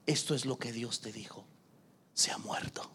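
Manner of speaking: low, hushed verdict fading to a whisper; hushed, final words from a naysayer; grim pronouncement. Retold or imitated. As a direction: deliver the bad news low and softly. Voicing those who declare dreams dead.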